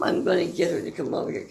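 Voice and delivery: ordinary talk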